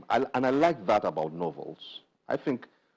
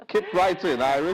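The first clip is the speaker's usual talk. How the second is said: laughing, higher and louder encouragement; laughing cheer; 9 semitones higher, 5 dB louder than usual. Real, not acted. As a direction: cheer her on through a laugh. Host cheering author on laughing.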